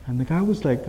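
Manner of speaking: ordinary delivery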